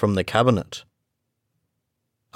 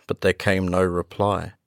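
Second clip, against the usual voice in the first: ordinary delivery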